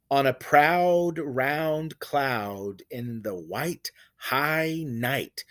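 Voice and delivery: slow, drawn-out poetic reading; slow recitation stretching the long vowels; poem reading. Shown as a demonstration. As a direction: read it slowly, savoring each vowel. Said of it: "Let's consider these examples".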